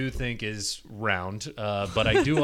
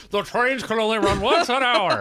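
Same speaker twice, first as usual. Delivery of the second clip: put-on high, loud old-timey voice; 1950s narrator; 9 semitones higher, 6 dB louder than usual. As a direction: play a pompous old-timey official. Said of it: "Why does he have an old-timey voice?".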